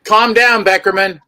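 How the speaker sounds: loud, playful call-out; joking shout. Real, not acted. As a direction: yell it at a buddy for laughs. Joking shout at a friend on stream.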